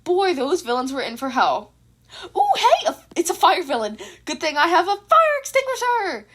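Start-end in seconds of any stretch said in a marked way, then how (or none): 2.3-6.3 high, loud, excited shout; excited yell. A narrator's reading. Izuku spots a fire villain, yells with glee. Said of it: "Izuku yelled out".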